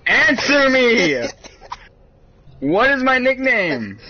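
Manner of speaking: high, loud, slow shout; loud, high-pitched frustration; exasperated demand. Real, not acted. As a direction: demand an answer at the top of your voice. Demanding an answer from Siri.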